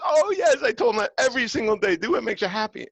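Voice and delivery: high, loud, proud mom; imitating the mother. Mother Deborah voiced proudly.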